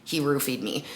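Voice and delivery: low, hushed realization to yourself; quietly retold alarm; inner thought. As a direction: drop it down soft, as a private thought. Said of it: "in my head then".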